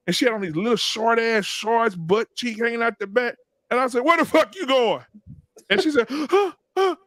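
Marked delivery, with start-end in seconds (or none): 4.0-5.0 high-pitched comic dad challenge; raised, indignant demand; Bernie Mac. Retold or imitated. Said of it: "turned straight into Bernie Mac". 6.1-6.9 high-pitched caught-out gasps; mock startled "huh, huh". Acts out startled daughter caught.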